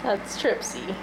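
ordinary talk

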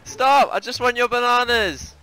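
high, loud panicked pleading; being chased. Real, not acted. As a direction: shout while fleeing in panic. Being chased by enemy ship.

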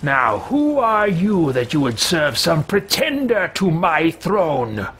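slow, grand villainous boasting; megalomaniac rant. Pretend. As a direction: gloat like a mad king. Player gloats over slain cultist.

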